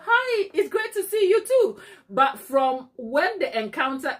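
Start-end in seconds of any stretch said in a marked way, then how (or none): 0.0-1.8 high-pitched effusive greeting back; gushing friendly reply. Retold or imitated. Greeting back someone she cannot place, gushingly friendly. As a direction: return the gush, pitched right up.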